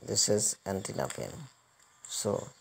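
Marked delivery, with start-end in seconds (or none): none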